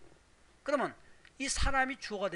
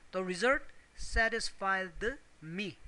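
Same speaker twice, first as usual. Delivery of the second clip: drawn-out "satisfied", set apart from "me"; careful classroom dictation of "The result satisfied me"; slow, deliberate; much slower than usual. Shown as a demonstration. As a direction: slowly spell out "The result satisfied me" word by word. Teacher builds the example slowly.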